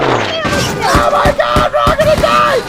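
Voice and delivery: high, shrieking hysterical yell; mock panic. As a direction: scream like the world is ending. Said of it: "they're like".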